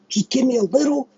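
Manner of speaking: high, strained plea; high-pitched desperate begging; pleading. Real, not acted. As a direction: beg for just a sliver, voice cracking up high. Pleading rant, voice jumps up.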